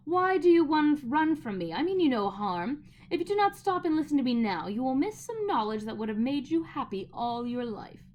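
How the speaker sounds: high, loud wheedling witch. A narrator's reading. Witch calling after Diggory to stop and listen, wheedling. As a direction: lure him in, sweet but commanding.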